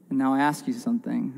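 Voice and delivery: usual speaking voice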